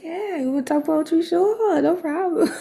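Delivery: high, bright mock-eager reply; playful high-pitched yes. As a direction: squeak out a chipper yes. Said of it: "I'm like".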